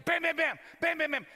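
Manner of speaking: high-pitched "bam" bursts; imitated banging. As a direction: punch out rapid banging noises. Imitates pounding on button.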